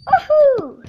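loud excited whoop; cheering whoop. Real, not acted. Cheering intro.

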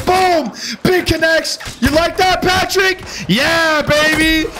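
real gamer hype; taunting hype shout. Real, not acted. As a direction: rub the kill in his face. Landing hits, taunting Patrick.

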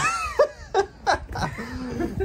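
a high, loud laugh breaking out; high burst of laughter. Real, not acted. Friend cracking up over how bagels is said, high laughter. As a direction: crack up at the joke.

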